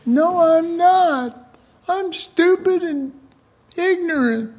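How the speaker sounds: mock the moping believer; hammed-up high whine of self-pity; whiny self-pity. Believer answers Jesus, self-deprecating.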